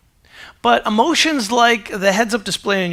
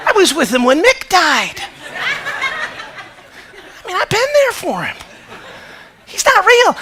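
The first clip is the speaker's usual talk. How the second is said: much higher, louder, laughing defence; mock earnest protest; 11 semitones higher, 6 dB louder than usual. Dad insisting to his kids he missed Rocky, laughing higher protest. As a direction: plead your case for a fictional hero.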